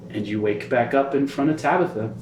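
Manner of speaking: low, hushed storyteller voice; low narration of a grim flashback; dark vision narration. A narrator's reading. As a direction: draw them into the memory.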